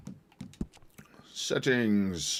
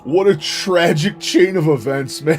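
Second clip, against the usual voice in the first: slow, loud, laughing through the words; laughing disbelief; 4 semitones higher, 8 dB louder, much slower than usual. Real, not acted. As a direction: savor the disaster while laughing. Laughing at the disaster.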